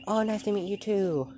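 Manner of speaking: high, loud, sing-song hello; bright greeting. Acted. Uraraka greeting the little girl, high and bright. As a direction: greet the child sweetly and high.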